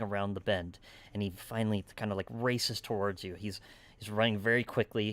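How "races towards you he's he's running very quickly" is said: normal talking voice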